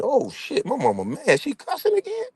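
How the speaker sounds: startled, rising in disbelief; shocked son. Retold or imitated. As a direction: caught off guard by mama cussing. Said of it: "I said".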